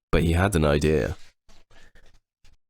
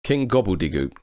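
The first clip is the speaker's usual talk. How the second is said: ordinary delivery, nothing marked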